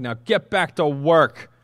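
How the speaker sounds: slow, loud commanding shout. A narrator's reading. Pharaoh orders the foremen off, shouting. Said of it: "But Pharaoh shouted".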